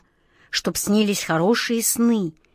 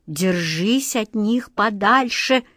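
usual speaking voice

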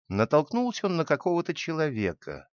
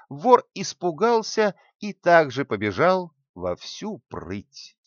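plain reading voice

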